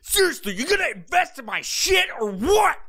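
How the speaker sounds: high, aggressive mock shout; amped up. Amped-up investor pitch at a stranger, aggressive shouting. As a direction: shout it like someone hyped on testosterone. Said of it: "they're fucking amped up".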